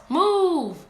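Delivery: one loud, high yelled word; Red shouts at the wolf, loud and high; angry shout. Acted. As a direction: snap it like an order. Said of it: "What else?".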